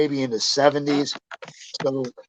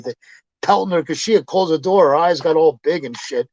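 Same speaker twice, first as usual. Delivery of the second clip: ordinary talk, nothing marked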